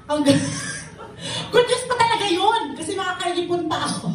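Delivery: words bubbling with real laughter; laughing. Real, not acted. Testifier laughing to the congregation about the good news. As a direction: laugh through it at the irony.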